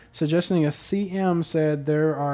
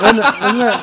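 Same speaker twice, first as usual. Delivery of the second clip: usual speaking voice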